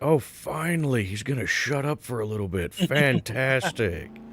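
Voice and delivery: high mock relieved voice; sarcastic relief. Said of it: "you guys are probably like".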